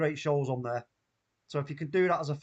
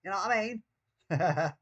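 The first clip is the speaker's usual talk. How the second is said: Mancunian accent; 2 semitones higher than usual. Shown as a demonstration. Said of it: "start talking like that".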